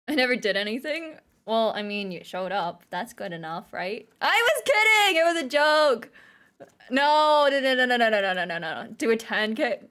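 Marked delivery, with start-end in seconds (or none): 4.2-6.1 loud pleading backpedal, pitched way up; mock-panicked protest. Mock panic at being held to joke. 6.9-8.9 loud run of pleading noes; frantic refusal. Pretend. Frantically backing out of challenge.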